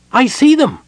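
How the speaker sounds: high, loud cry from a small kender; shrieky little lookout spotting the enemy; excited cry. A narrator's reading. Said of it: "Tasselhoff cried".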